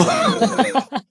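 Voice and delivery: high burst of real laughter; amused laugh breaking out. Real, not acted. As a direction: laugh out at the memory. Laughs at son's story.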